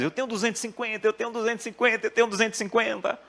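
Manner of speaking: high, fast, giddy chant of the amount; a boy bouncing round the house with glee; overjoyed child. Retold or imitated. Preacher imitating his son jumping for joy, high and fast. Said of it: "ele enlouqueceu. Começou a pu-, pular".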